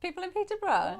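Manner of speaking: high, loud exclaimed repeat; exclaiming. Real, not acted. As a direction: exclaim it back. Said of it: "repeated, exclaiming".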